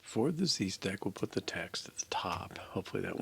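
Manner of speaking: voiceless murmur while typing; whispered self-talk. Real, not acted. Coder planning his next edit, whispered. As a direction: mutter the plan under your breath. Muttering to himself while coding.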